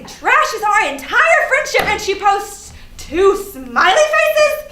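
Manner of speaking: appalled at the smiley faces; incredulous outrage. Real, not acted. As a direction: stretch it out, appalled. Outraged at friend's reply.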